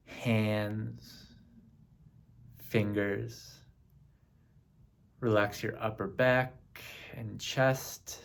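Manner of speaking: slow, measured meditation voice with long pauses; name each part unhurriedly, leaving space to relax; guided body scan. Acted. Meditation leader guiding listeners through the body, slow and spaced.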